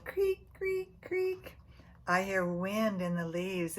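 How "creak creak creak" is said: cricket creak. Retold or imitated. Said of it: "They go".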